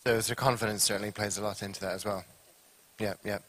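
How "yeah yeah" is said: ordinary talk, nothing marked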